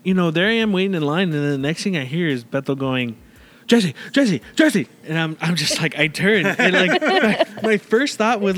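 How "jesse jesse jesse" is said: high, loud repeated name call; imitated calling out. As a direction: call out to get someone's attention, retold. Retold calling out for attention.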